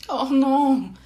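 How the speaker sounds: loud, high cry of dismay; dismayed exclamation. A narrator's reading. Charlotte as the rain starts, dismayed exclamation. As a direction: exclaim in protest. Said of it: "s'exclama Charlotte".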